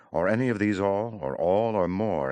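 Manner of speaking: a rushing, climbing crescendo; building fervour. Acted. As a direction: pile the words up with rising passion. Building list in sonnet.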